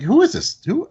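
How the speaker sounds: high, loud baffled question; baffled who is this. Retold or imitated. Boss asking staff about the complaining customer, high and baffled. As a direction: demand who she is in disbelief. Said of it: "I'm like".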